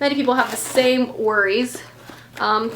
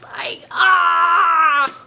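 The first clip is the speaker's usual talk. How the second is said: a choked little cry of joy; overwhelmed cry. Real, not acted. Vlogger overcome by the special edition, crying out. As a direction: let the joy break out.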